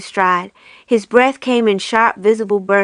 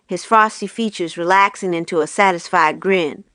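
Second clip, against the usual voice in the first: plain reading voice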